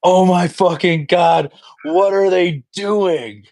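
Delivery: high, slow, aghast cry of disbelief; a stunned fan who can't believe it; drawn-out horrified groan at the news; shocked disbelief. Retold or imitated. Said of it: "and he goes".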